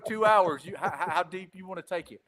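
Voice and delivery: ordinary talk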